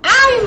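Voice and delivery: sudden yelp as a bone cracks; a short startled "ay" of pain; sharp cry of pain. Real, not acted. (grito corto de dolor)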